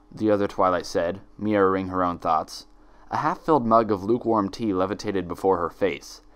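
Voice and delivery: ordinary delivery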